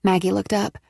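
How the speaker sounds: usual speaking voice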